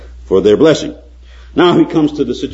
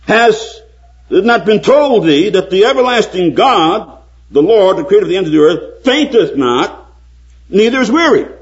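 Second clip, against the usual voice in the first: building preaching; 10 semitones higher, 4 dB louder than usual. Acted. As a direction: hammer each question home, building. Quoting Isaiah, more forcefully.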